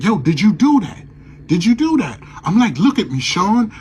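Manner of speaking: high, loud, urgent demand; frantic shouting. Retold or imitated. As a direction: shout at him to look at you.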